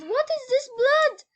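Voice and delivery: high, loud alarmed shout; frightened cry. A narrator's reading. Scared girl finding blood on the floor, frightened cry.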